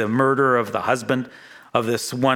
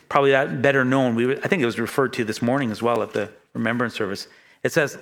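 ordinary talk, nothing marked

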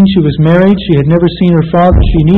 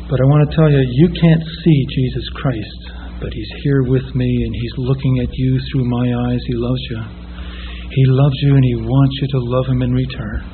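tender plea; 5 semitones lower, 8 dB quieter than usual. Retold or imitated. Preacher to a young woman on the street, soft and tender. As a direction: coax her gently, as if only she can hear.

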